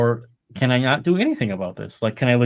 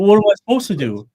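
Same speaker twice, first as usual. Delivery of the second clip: higher, louder, faster mock whine; mock whiny complaint; 8 semitones higher, 7 dB louder, faster than usual. Imitating a complaining friend, a high fast mock whine.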